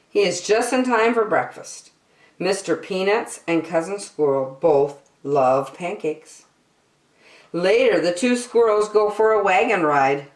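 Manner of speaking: ordinary delivery, nothing marked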